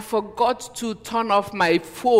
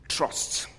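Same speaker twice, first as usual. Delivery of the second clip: breathy whisper of "Trust"; whispered word; 5 dB quieter than usual. Acted. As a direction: whisper it as a weighty point. Solemn one-word sermon point.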